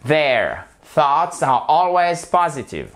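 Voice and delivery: slow careful English; slow model reading, stress on POS in "positive"; model sentence. Shown as a demonstration. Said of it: "l'accent tonique est sur la première syllabe". (lecture modèle lente, accent sur "POsitive")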